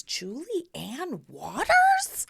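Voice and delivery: high, slow, stretched name; drawn-out surprised name call. Retold or imitated. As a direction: call the full name in disbelief. Recalling being called out.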